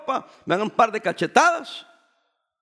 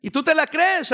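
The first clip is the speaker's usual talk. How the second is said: usual speaking voice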